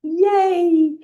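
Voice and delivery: high, loud cheer of yay; excited cheer. Shown as a demonstration. Teacher shows the class an excited yay, cheering high. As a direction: throw the arms up and cheer. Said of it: "when you change your body posture".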